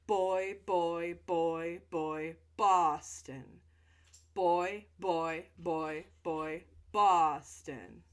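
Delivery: put-on loud, punchy Boy and Boston with popped B's; loud jaw-relaxing chant of Boy and Boston; loud repeated phrase. Shown as a demonstration. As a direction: pop each B hard to loosen the jaw. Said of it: "So just do that a few times".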